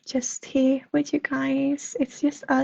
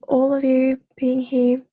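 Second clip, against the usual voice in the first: ordinary delivery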